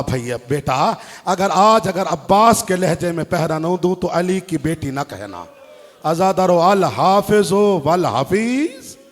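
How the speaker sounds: normal talking voice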